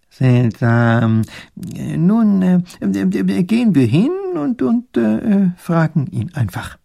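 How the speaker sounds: höher, stockend mit vielen Ähs; zerstreuter Professor. Acted. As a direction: ein verwirrter alter Gelehrter sucht die Worte. Professor stockt, sucht Worte. (zerstreuter Professor, höher und stockend)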